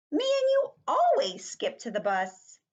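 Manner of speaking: put-on high, loud child protest; high-pitched kid voice; little-girl friend Grace. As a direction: complain like an insistent schoolgirl. Voicing Grace in the story.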